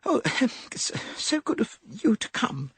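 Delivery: high, breathless gush with a laugh; gushing, gasping. A narrator's reading. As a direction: gush eagerly in spasms. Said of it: "gasps and was distinctly spasmodic".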